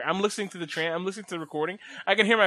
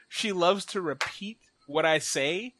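normal talking voice

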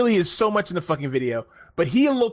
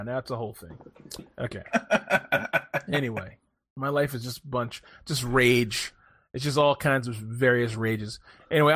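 normal talking voice

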